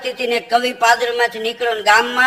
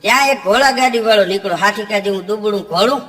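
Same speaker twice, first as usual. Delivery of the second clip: ordinary delivery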